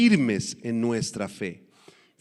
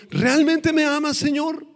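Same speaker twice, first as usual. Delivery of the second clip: high, louder opening on each incredulous question; doubting question; 9 semitones higher, 4 dB louder than usual. Acted. Believer questioning God in trials, doubting and high-pitched. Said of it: "en nuestras dudas, en nuestra carnalidad ... le digamos".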